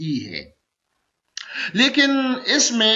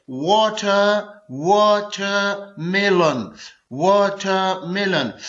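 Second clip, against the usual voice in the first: Watermelon very slowly, वा-टर-मे-लन; syllable by syllable; much slower than usual. Shown as a demonstration. Teacher demonstrating a four-syllable word, watermelon slowly split in four. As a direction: stretch out each beat of watermelon. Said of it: "ये four syllable word है".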